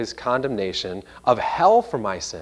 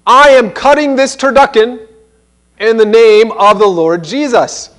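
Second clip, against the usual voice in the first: mock announcement; 8 semitones higher, 9 dB louder than usual. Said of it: "or announce".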